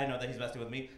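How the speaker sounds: ordinary delivery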